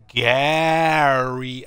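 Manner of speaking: loud, long stretched name; big elongated greeting call; drawn-out shout-out. Real, not acted. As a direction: holler the name, holding the vowel. Shout-out to a viewer.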